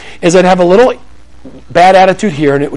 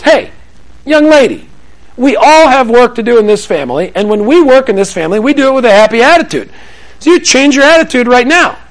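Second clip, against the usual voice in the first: raised, scolding mom-or-dad voice; stern parent lecture; 7 semitones higher than usual. Retold or imitated. Scolding a daughter for her bad attitude, stern lecture voice. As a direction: lay down the family rule to a sulking kid. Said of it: "Standard parenting response is".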